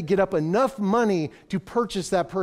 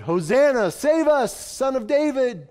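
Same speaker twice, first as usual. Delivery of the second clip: crowd shout; 7 semitones higher, 4 dB louder than usual. Retold or imitated. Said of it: "the very crowds that shouted".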